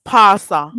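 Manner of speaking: pasa said loud, no T and no R; patois pastor. Shown as a demonstration. Teacher drilling pasa loudly. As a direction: pasa with an open ah and no T. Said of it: "Don't say it the way you say it".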